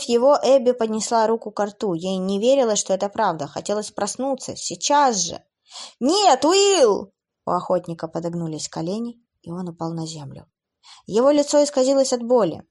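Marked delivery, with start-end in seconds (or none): 6.0-7.1 anguished cry. Acted. Sees him stabbed.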